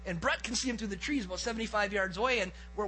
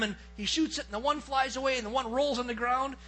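usual speaking voice

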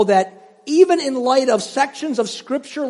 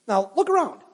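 ordinary talk, nothing marked

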